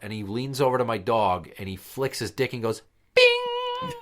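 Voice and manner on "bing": squeaky high bing of a flick; a sharp, very high "bing". Retold or imitated. Friend flicking the dog, a squeaky high sound effect.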